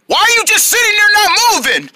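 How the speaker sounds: high, whiny outburst; high-pitched frustrated complaint; exasperated demand. Acted. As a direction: demand an answer, losing patience. Losing patience with silent friend.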